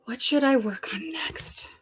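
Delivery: murmured to herself; whisper. Real, not acted. To herself, wondering what to craft next, in a whisper. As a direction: whisper it while thinking, slowly. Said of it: "Where's my glasses?".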